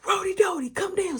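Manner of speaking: high-pitched call to come down; retold upstairs holler; mama calling. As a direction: imitate a mother summoning her child. Mama calling child downstairs.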